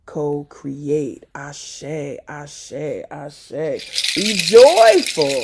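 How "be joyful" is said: slow, loud and high; joyful call. Real, not acted. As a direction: stretch it out, uplifting. Exhorting joy.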